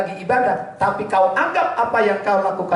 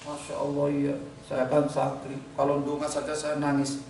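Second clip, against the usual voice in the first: smug self-praise; 4 semitones lower, 10 dB quieter than usual. Acted. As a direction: mutter your own piety proudly. Voicing a proud santri.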